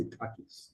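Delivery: "Takis" with the final S kept, quietly; name with the S. Shown as a demonstration. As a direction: say "Takis" keeping the S. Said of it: "you keep the S, you say".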